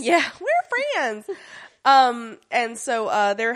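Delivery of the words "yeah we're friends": mock chummy denial. Echoing the mock Wednesday voice.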